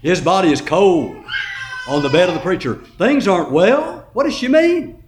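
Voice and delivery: loud, ringing sermon delivery; preaching at full force. Acted. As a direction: preach it to the back row.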